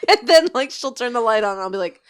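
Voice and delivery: ordinary talk, nothing marked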